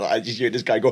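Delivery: ordinary talk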